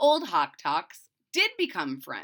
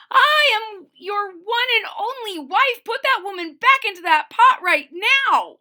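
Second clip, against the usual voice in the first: high, loud furious wife yelling; angry cry; 11 semitones higher, 11 dB louder than usual. Acted. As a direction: shriek it at the husband. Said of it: "the first Missus Hawk Talk cried".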